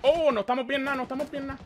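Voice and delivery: high, sudden alarmed outburst; alarmed realization. Real, not acted. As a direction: snap from calm to alarm. Realizes they're under fire.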